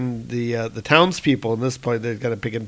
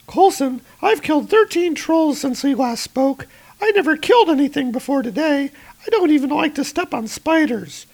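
much higher, a nervous half-laugh; a rattled first-time fighter; shaken Roz; 4 dB louder than usual. Acted. Roz shaken after killing trolls.

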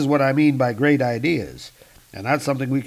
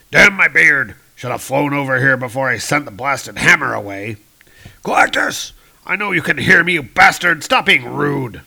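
put-on loud, booming old-king bellow; yelling; 6 dB louder than usual. Old Man King Thor yelling at Galactus, loud and indignant. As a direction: roar across space at a giant. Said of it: "he's yelling at Galactus".

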